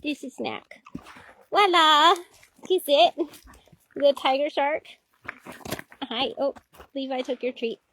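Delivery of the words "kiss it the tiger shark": ordinary delivery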